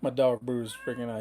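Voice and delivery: ordinary delivery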